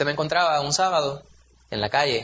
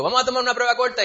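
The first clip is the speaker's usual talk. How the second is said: brisk teacher announcement; 8 semitones higher, faster than usual. Retold or imitated. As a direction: rattle it off like a teacher springing a quiz. Teacher announcing quiz again.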